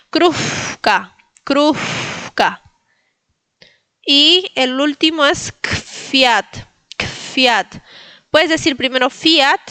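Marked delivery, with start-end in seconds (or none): 0.1-2.6 "krufka" with the F blown like a candle. Shown as a demonstration. Teacher imagines blowing birthday candle, blown F in "krufka". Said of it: "pero soplando". 5.5-7.8 "kfiat" with K straight into F. Shown as a demonstration. Teacher gives last example, "kfiat" with W as F. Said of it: "en las dos últimas como F".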